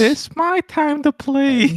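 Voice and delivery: put-on high, drawn-out childish whine; bratty kid. Friend mocks the mask thief, high bratty kid voice. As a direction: whine it like a spoiled little kid.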